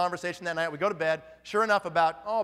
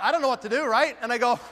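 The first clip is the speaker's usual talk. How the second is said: normal talking voice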